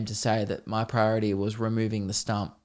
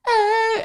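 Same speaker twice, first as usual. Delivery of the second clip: very high wailed "ah"; mock sung scream; 3 dB louder than usual. Poisoned weeds singing along, high mock scream. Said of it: "also screaming 'cause they're being fucking poisoned".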